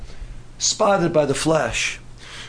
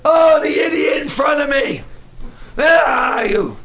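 higher, louder mock driver's rage; road rage shout; 7 semitones higher, 3 dB louder than usual. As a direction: yell at the car ahead like a furious driver. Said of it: "you come up with road rage".

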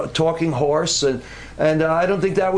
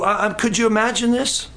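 normal talking voice

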